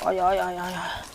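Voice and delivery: fading soft ow ow ow moans; pained moans. Acted. Beaten husband moans in pain, fading.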